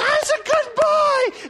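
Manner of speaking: very high, drawn-out shouted praise; big squealed praise as if to a puppy; gushing dog praise. Acted. Owner praising the dog for not peeing indoors, gushing.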